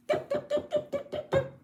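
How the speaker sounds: very high, light spoken knocks; knocking sound. Acted. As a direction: tap it out lightly. Knocking again.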